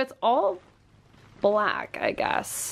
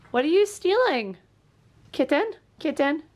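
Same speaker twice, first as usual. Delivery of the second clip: high pet talk; 7 semitones higher than usual. Real, not acted. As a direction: coo at the kitten. Talking to the cat.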